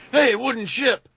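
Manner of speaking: high playful call-out; teasing yell; mock warning call. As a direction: shout it up at the ship in fun. Calling out warning jokingly.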